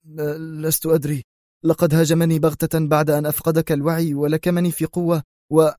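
nervous stammer; frightened excuse; alarmed answer. Acted. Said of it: "أجابه مارياني في ارتياع".

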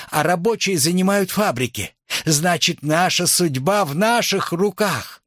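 high, urgent orator's call; rally the comrades, pitched well up; rousing appeal. A narrator's reading. Nicole making a last attempt to win over the audience, rousing. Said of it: "сделала последнюю попытку завладеть аудиторией".

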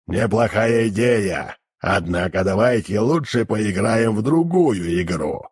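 demon voice. Acted. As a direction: drone it like a hellish creature. Demon keeps his voice.

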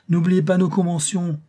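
high loud call. A narrator's reading. Mascaro calling to the couturier as he leaves, high and loud. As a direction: call back on the way out. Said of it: "cria-t-il à Von Kloppen".

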